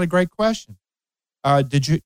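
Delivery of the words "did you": normal talking voice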